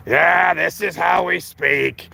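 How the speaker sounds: slow, put-on stately accent, a touch of a shout at first; mock Bob Arum. Mocking the promoter's New York speech, slow and exaggerated. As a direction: lampoon a promoter who thinks his accent is normal. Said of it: "Bob Arum speaks English".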